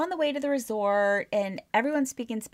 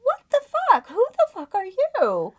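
loud high-pitched disbelief; shocked outburst; 12 semitones higher, 7 dB louder than usual. Retold or imitated. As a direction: blurt it out in stunned disbelief. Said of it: "I'm like".

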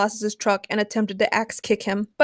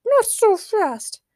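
very high, very slow commanding squeak; turkey ruler; 12 semitones higher, 4 dB louder, much slower than usual. A narrator's reading. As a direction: drag it out grandly from above. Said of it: "said a voice from above".

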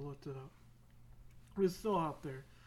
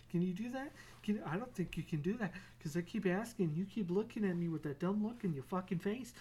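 hammed-up cat taunt; 3 semitones higher than usual. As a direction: taunt him over the scratching. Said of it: "Be like".

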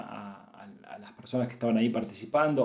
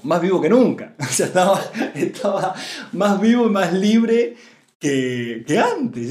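higher and louder, with a laugh of delight; joyful excitement; 6 semitones higher, 7 dB louder than usual. Real, not acted. Speaker to listeners, marveling that his late father is more alive, excitedly. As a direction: burst out happily.

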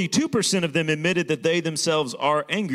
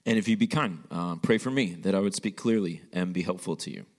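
lower, hushed prayer voice; 6 semitones lower than usual. Acted. Pastor leads an opening prayer.

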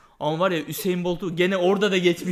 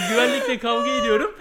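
ordinary delivery

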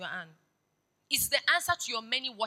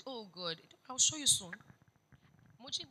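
ordinary talk, nothing marked

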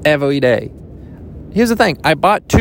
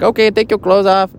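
higher-pitched masseuse in a badly faked Japanese accent; botched Japanese accent; 7 semitones higher than usual. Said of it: "that was not even the right accent".

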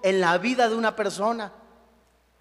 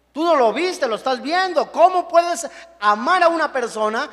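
ordinary talk

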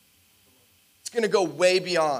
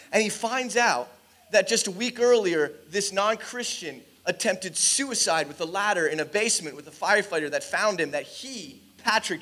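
usual speaking voice

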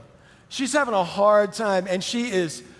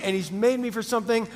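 ordinary talk

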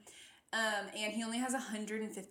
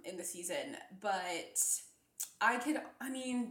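ordinary talk, nothing marked

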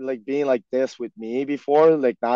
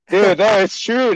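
laughing; 7 semitones higher, 7 dB louder than usual. Real, not acted. Laughs while praising teammate.

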